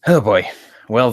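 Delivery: ordinary talk, nothing marked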